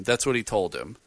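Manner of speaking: normal talking voice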